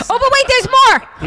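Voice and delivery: loud, high mock pitchman; infomercial announcer. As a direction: belt it like a TV ad host.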